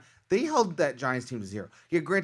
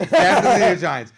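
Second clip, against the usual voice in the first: loud, higher, laughing repeat; laughing emphasis; 5 semitones higher, 11 dB louder than usual. Real, not acted. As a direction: laugh out the pick loudly. Repeats the pick amid laughter.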